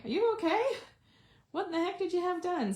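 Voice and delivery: high-pitched quote of fussing well-wishers; imitating concerned messages. Crafter quoting viewers' messages after her absence, high mock-concerned voice.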